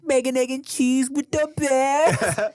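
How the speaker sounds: high, stretched-out New York catchphrase; Aki. Retold or imitated. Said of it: "they're quoting Aki".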